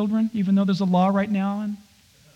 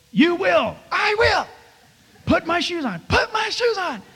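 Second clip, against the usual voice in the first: higher, joking drill-sergeant orders; playful bossy commands; 7 semitones higher than usual. Pretend. Dad joking with his little girls, mock sergeant orders. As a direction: bark mock orders at the kids, pitched up. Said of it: "and I go".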